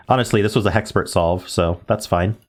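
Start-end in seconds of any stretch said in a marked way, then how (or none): none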